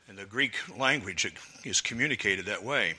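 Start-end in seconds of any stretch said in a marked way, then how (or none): none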